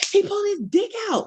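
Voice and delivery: imitating her own panicked call to police; panicked retelling. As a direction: relive the shock in a high voice. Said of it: "I just kept saying".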